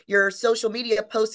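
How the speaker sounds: ordinary talk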